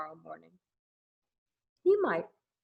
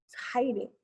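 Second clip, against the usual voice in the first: usual speaking voice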